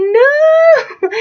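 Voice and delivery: very high, loud, drawn-out no; dismayed cry. Retold or imitated. Reacting to two tests tomorrow, high dismayed wail.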